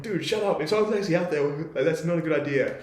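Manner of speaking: high, urgent telling-off; alarmed scolding. Retold or imitated. Camper to friend screaming into the dark, alarmed scolding. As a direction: shut a friend up before he draws attention.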